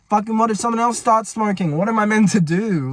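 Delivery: high-pitched, worked-up worrying; retold panic. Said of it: "I'm, I'm panicking".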